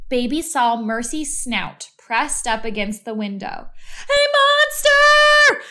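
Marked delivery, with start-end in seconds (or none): 4.2-5.6 high, loud, slow scream of fright; frightened shout. A narrator's reading. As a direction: yell it as if a monster is at the window. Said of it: "shouted Baby".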